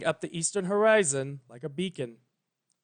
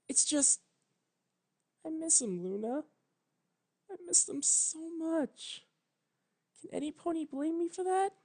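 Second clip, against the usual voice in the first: tearful grief; 13 semitones higher, 7 dB quieter than usual. A narrator's reading. Twilight admits to Luna she misses them, soft high tearful voice. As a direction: break down softly while admitting it.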